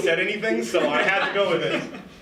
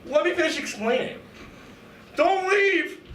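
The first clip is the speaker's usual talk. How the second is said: mock desperate plea; 12 semitones higher, slower than usual. Kid pleading, shouting.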